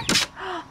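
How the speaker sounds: quick, breathy gasp of suspense; suspenseful gasp; sharp gasp. Real, not acted. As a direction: gasp as the pet leaps. Pet nearly reaches food.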